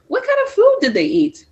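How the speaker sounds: put-on high, quick eager child's voice; child asking. Tristan asks Mister Clark about food, high and quick like a child. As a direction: pipe it up like a curious kid.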